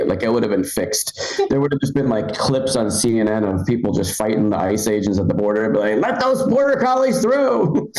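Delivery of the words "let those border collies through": high, drawn-out protester plea; mock protest cry. Protesters fighting agents at the border, mock outcry.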